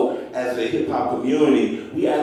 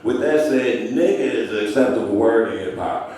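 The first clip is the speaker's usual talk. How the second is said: ordinary talk, nothing marked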